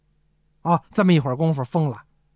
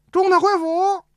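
high announcement cry; calling out; 11 semitones higher than usual. Acted. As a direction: call it across the courtyard. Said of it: "就听外边有人喊". (高声通报)

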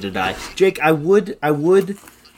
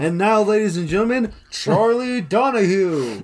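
game show host; 6 semitones higher than usual. Acted.

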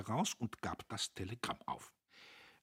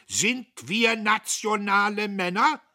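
rousing rhetorical shout; 13 semitones higher, 12 dB louder than usual. Acted. Dietrich rallies the table.

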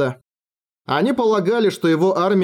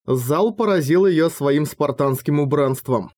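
ordinary talk

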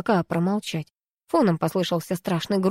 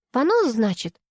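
ordinary delivery

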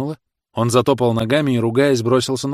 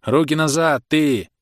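raised-voice order to a prisoner; loud command; 6 semitones higher, 4 dB louder, slower than usual. A narrator's reading. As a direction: order him about in a higher voice. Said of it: "громко сказал ему". (громкая команда)

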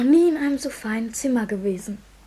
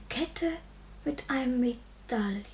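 awed whisper; 4 semitones lower, 8 dB quieter than usual. Acted. Boy repeats word in awe.

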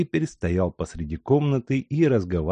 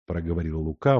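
plain reading voice

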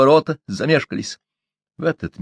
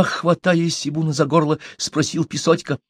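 plain reading voice

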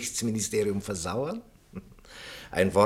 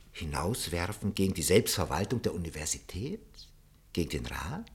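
whispered aside; 5 semitones lower, 5 dB quieter than usual. Acted. Anxious objection, half to himself.

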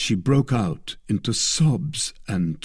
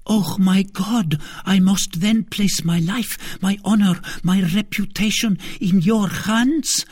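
high, pained queen voice; a queen risking everything; anguished queen; 10 semitones higher, 3 dB louder than usual. A narrator's reading.

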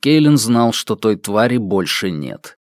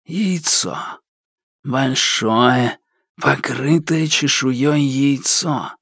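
slow, cold voice of a dark spirit; sinister shadow creature; 2 semitones higher, 3 dB quieter, slower than usual. Acted. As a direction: speak like a creeping shadow demon. The shadow demands the egg.

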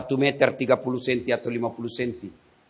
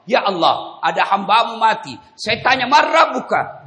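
angel reporting to God; 8 semitones higher, 5 dB louder than usual. Acted. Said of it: "Dia naik ke arasnya Allah".

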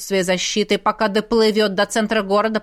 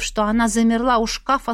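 ordinary delivery

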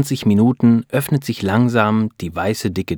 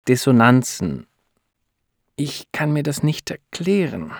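halting, raised voice with awkward pauses; uneasy; 5 semitones higher than usual. A narrator's reading. Doctor breaking awkward news to a couple, uneasy. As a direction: search hesitantly for the right words. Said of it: "fühlt sich nicht gut in ihrer Rolle".

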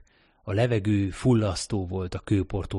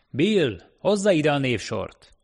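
high, raised-voice order; calling out; 7 semitones higher, 4 dB louder, slower than usual. A narrator's reading. Foreman calling Bill for the list, calling out. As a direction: call across to an assistant. Foreman calls to his assistant.

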